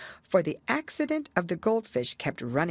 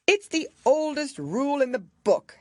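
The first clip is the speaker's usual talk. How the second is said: blustering king; 10 semitones higher, 5 dB louder than usual. A narrator's reading. King bluffs.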